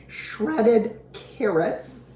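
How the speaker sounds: normal talking voice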